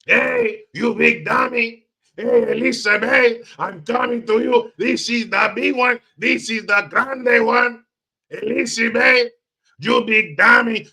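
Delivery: high, loud mock Red Foxx with a Spanish accent; Mexican Red Foxx. Riffing on Kamala as a Mexican Red Foxx, high and loud. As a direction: shout like Fred Sanford with a Mexican twist. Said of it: "sounds like a Mexican Red Fox".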